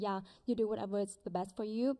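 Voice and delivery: ordinary talk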